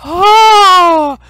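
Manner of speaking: high, loud, long crowd "oh"; villagers' "ooh". Acted. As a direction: sound like an astonished crowd. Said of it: "the villagers are like".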